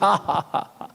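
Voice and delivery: mock happy "hahaha"; imitated laughter. Preacher showing how they laughed while others repented, imitated laughter. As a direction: act out helpless laughing during prayer. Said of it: "웃고 있어요".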